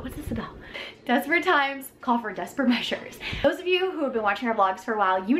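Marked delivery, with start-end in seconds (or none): 0.0-0.6 dramatic whisper. Acted. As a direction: whisper it like a secret. Sudden whisper to camera.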